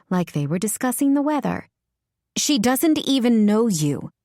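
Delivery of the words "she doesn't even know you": seething through gritted teeth. A narrator's reading. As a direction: speak through clenched teeth. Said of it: "Lauren said through gritted teeth".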